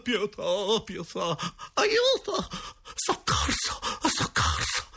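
high, strained mock cries for help; grida spaventate. A narrator's reading. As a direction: plead in hushed-down, hammed-up screams. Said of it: "le grida dei tre spiritisti sotto i primi colpi". (grida soffocate di terrore)

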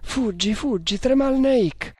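high-pitched panicked urging; terrified. A narrator's reading. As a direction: beg him to flee, voice leaping high in terror. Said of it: "fuori di sé per il terrore". (fuori di sé per il terrore)

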